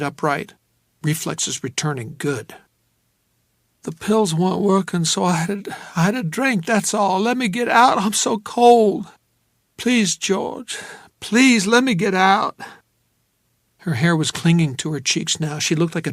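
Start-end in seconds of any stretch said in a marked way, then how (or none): 3.8-12.6 cold, tearful woman pleading with George. Acted. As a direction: beg to be let out.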